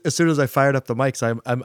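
ordinary talk, nothing marked

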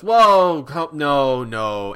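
loud, high startled crowd; alarmed whoa. Retold or imitated. Passengers react to the slur, loud alarm. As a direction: cry out to stop it. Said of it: "everyone else just goes".

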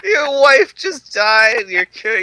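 high, slow, exasperated outburst; hammed-up pleading exasperation; incredulous mock protest. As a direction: call him out in disbelief. Mock retort to the film's hero.

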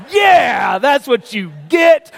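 loud, high, drawn-out triumph; slow, exultant yell; gloating shout. Acted. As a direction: crow over a rival's comeuppance. Gloating at the trucker's mishap.

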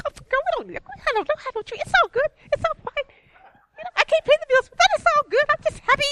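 hammed-up happy friend, squeaky high and loud; Mary. Imitating a friend happy without tree or money, giddy high voice. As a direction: chirp cheerfully through every hardship. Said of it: "She was just happy".

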